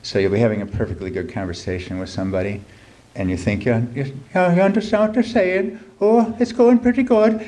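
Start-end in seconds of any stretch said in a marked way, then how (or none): 3.6-7.4 much higher, louder put-on Irish lilt; exaggerated Irish accent. Reader slipping into a comic Irish accent. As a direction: ham up a thick Irish accent, baffled. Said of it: "a good Irish accent".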